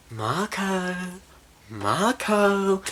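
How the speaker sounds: soft, high sing-song calling; creepy stalker call. Retold or imitated. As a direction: call softly, drawing out the name. Possessed father stalks his daughter.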